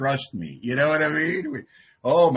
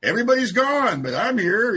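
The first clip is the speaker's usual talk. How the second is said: a hint of a dazed survivor, raised; mildly wondering, bit louder; lightly voiced dazed cockroach survivor; 6 semitones higher, 4 dB louder than usual. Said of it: "it's like".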